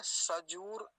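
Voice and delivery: सजूर with the second vowel drawn out; teacher's careful सजूर, oo held long. Shown as a demonstration. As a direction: model सजूर slowly with a long oo. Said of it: "बड़े ऊ की मात्रा को ध्यान से बोलेंगे".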